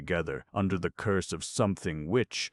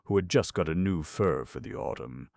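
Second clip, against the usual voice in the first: plain reading voice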